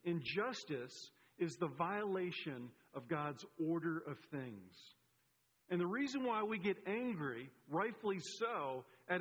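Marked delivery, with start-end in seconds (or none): 0.0-4.9 slow, deliberate restatement; measured and weighty, letting each phrase land; emphatic repeat. Shown as a demonstration. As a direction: spell out the definition slowly, phrase by phrase. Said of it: "Let me say that again".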